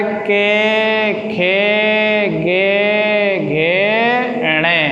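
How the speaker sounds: slow syllable-by-syllable recitation; teacher sounds out each letter of the k-row; sounds recited. Shown as a demonstration. Teacher drills pupils in reciting the guttural letters clearly. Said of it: "के वर्ग में कौन से आ गए".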